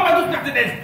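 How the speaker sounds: ordinary delivery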